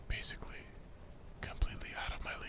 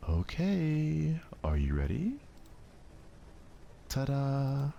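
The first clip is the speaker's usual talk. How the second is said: high, loud showy ta-da; proud reveal; 7 semitones higher, 6 dB louder, slower than usual. Acted. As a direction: present the cupcakes with a flourish.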